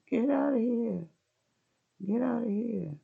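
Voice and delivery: high, loud mock yell of the husband; his shout. Husband yelling at wife to leave the room, loud high shout. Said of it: "He says".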